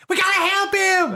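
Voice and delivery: high, loud mock-frantic cry; acted panicked scream. Game master as Gemma urging a rescue, screaming. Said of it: "But Gemma screams".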